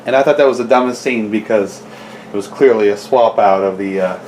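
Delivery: normal talking voice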